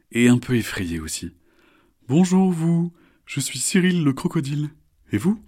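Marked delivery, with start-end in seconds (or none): 2.1-5.4 high, cheerful crocodile; friendly crocodile voice. Acted. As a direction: greet them like a charming croc. Cyril the crocodile greets.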